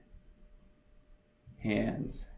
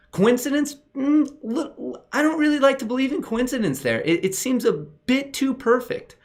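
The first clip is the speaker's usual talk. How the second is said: mock suspicious; 12 semitones higher than usual. Mock-suspicious market coincidence.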